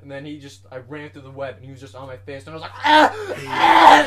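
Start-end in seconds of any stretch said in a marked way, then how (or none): 2.8-4.0 two piercing high loud screams; terrified screams. Retold or imitated. Retelling a spider on his face, screaming in panic. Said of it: "I started freaking out".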